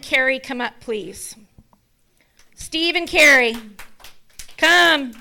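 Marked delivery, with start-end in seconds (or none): 2.5-5.1 loud call across the room; wife summoning a couple to the stage, calling out. Real, not acted. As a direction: call them up to the front.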